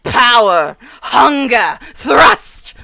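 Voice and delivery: mock-dramatic emphasis, climbing to a near-shout on "thrust"; imitating his emphasis. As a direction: hit every word, building to "thrust". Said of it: "he says things like".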